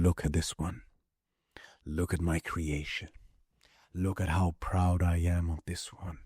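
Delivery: low, soft and proud; lightly voiced, gently proud God; proud tender voice of God. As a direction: murmur it like a proud creator. God proudly speaking of creation.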